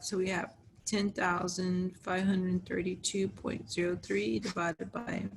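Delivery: ordinary talk, nothing marked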